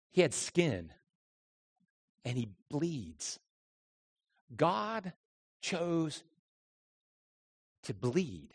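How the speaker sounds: slow, soft, reverent hush; hushed pulpit reverence; hushed and solemn. Real, not acted. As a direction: let the words sink in quietly. Solemn moment of Christ's suffering.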